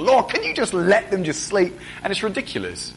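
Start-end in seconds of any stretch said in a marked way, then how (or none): 0.0-1.7 higher, weary complaint to God; half-laughing frustrated plea for sleep; exasperated plea. Retold or imitated. Tired parent pleading with God over the baby's night waking, exasperated. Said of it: "I'm bitter and I'm angry".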